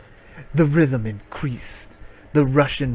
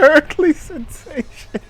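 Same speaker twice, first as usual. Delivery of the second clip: high, loud, slow mock quote; mocking repeat; 6 dB louder than usual. Real, not acted. Streamer mocks the story's phrase, slow and high. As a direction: savor the silly phrase mockingly.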